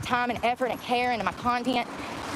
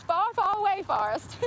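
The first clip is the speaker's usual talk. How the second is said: sing-song storyteller reply; 8 semitones higher than usual. Pretend. Answering own pretend passenger.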